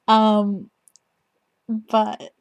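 ordinary talk

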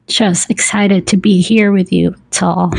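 ordinary delivery

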